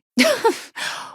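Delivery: a sharply rising, amused laugh; high laugh. Acted. Companion laughs at the banter.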